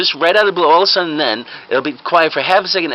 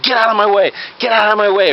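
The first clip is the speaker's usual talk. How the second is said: higher-pitched, acted urgency; urgent command; 7 semitones higher than usual. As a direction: order people aside like a doctor rushing in. Fake doctor pushing through crowd.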